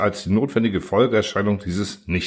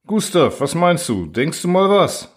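officer voice; 8 semitones higher than usual. Acted. Lieutenant voiced in story.

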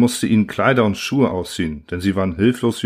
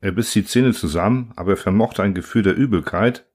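ordinary delivery, nothing marked

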